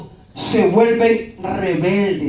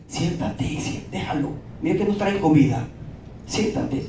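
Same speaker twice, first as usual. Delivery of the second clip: softer, hushed urging to sit down; calming hush; 5 semitones lower, 7 dB quieter than usual. Retold or imitated. Friend hushing the heckler so they keep getting food, softer. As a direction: quietly settle a friend down.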